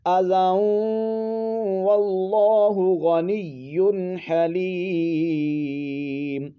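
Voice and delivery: slow, melodic, each word set apart; teacher's model recitation; Quranic recitation. Shown as a demonstration. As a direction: chant the verse with long pauses. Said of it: "پھر اس کو پڑھیں گے".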